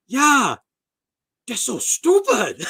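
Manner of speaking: a hammed-up martial arts teacher laughing loud and high; mock martial arts teacher. Martial arts teacher laughing about his beginner students, a hammed-up shout. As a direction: shout it out with a laugh, slowly, like the exasperated sensei. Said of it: "And he goes".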